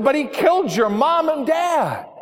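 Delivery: high, accusing call from the back; shouted out. Acted. Someone at the back accuses the grieving son, high shout. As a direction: fling the accusation across the room. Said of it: "shouted out".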